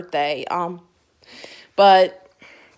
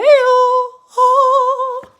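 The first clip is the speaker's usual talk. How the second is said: sung oh; 9 dB louder than usual. Real, not acted. Seeing her favourite character.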